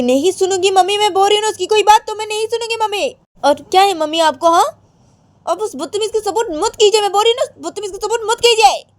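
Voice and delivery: put-on high, loud whiny girl's voice; shrill-pitched stubborn daughter; petulant Radhika. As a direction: complain high and loud to mum like a spoilt girl. Radhika complaining to mother.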